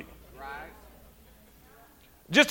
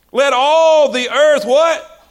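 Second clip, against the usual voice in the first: louder, slow, rising prompt; call for response; 3 semitones higher, 6 dB louder, much slower than usual. Acted. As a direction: hold it out for the crowd to answer. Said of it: "Keep silent before him".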